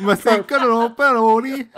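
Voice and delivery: high, loud fake Swedish with Italian lilt; mock Italian-sounding Swedish. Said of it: "we do sound a bit Italian like that".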